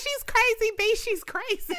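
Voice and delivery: high, loud panicked whine. Retold or imitated. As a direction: act out a scared guy's plea. Quoting the scared boyfriend.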